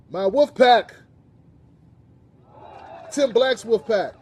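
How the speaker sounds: loud, high-pitched rallying shout-out; calling out. Real, not acted. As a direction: announce the fan group like a hype man. Host proudly calling out fans' group name.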